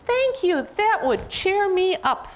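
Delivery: very high, slow little character voice; Piggy. Acted. Piggy in elephant's imagining, accepting ice cream, sweetly high. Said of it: "And then she will say".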